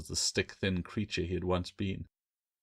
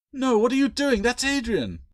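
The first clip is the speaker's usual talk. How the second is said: much higher, louder frantic cry; alarmed shout; 7 dB louder than usual. A narrator's reading. Claire trying to stop Bill's sword, frantic shout. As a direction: scream at him to stop.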